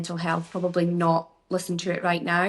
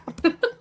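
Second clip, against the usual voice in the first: laugh; 11 semitones higher, 3 dB louder than usual. Real, not acted. Laughs at her own joke.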